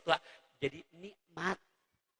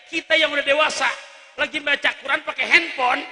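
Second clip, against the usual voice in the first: loud, fast, rousing sermon; fiery preaching; 8 semitones higher, 11 dB louder than usual. Acted. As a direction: press the congregation hard. Preaching at full force.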